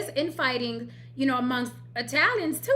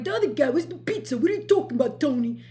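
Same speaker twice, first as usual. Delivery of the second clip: fast, hammed-up Italian-American caricature; mock Italian-American; 3 semitones higher, faster than usual. Host imitates the guy not being celebrated, mock Italian-American. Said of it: "Why are you speaking that way?".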